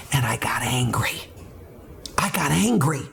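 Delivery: hushed, breathy whisper of anger; whispered confession. Real, not acted.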